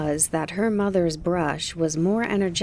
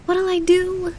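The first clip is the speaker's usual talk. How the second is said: 1 distressed cry; 13 semitones higher, 5 dB louder than usual. A narrator's reading. Kiki finds her broom broken.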